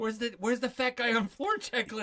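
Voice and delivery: high mock panicked clerk; high, thin clerk who has no checklist. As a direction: squeak like a lost new hire. Mocking the clerk.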